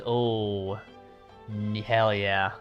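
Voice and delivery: ordinary talk, nothing marked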